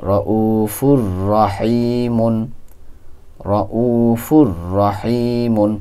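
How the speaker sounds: রউফুর রহিমুন chanted very slowly, ফুর and মুন held; slow recitation. Shown as a demonstration. Teacher demonstrating the tanween reading to students, very slow. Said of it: "এক পেশের উচ্চারণটা নিয়ে পড়া হচ্ছে".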